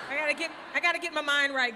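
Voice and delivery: usual speaking voice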